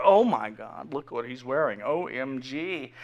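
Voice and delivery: low, hushed gossip; soft, low catty aside; whispering bystanders. Acted. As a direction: act a scandalized onlooker under the breath. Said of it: "bystanders are, are whispering".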